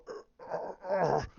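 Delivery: lightly voiced effortful grunts; straining grunts. Vader strains to pull the ship back, soft mock grunts. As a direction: mimic someone straining to hold something. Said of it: "You see him going".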